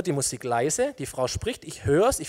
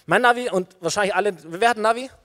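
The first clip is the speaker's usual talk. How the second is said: normal talking voice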